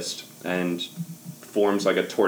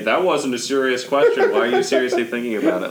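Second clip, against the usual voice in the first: sneering mimic of a friend; mocking imitation; 4 semitones higher, 4 dB louder than usual. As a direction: parrot it back sarcastically. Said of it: "I don't know how you're gonna ... say".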